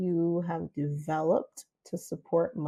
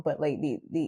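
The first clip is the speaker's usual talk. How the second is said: ordinary talk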